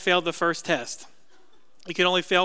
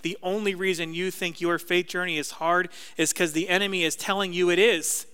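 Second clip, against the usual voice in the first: usual speaking voice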